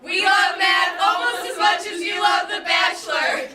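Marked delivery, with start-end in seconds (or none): 0.0-2.2 shouting. Real, not acted. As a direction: belt it out to the teacher. Shouting thanks to teacher.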